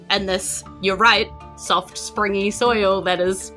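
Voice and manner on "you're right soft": normal talking voice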